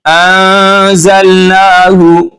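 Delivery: अंज़लनाहु very slow, held; stretched recitation. Shown as a demonstration. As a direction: draw it out. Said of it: "तीन अलिफ के बराबर खींचेंगे". (खींचकर पढ़ें)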